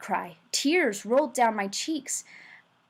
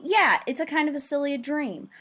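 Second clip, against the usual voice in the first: plain reading voice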